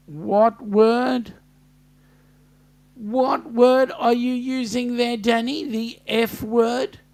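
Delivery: indignant mock interrogation. Real, not acted. Host questions a chat message more insistently.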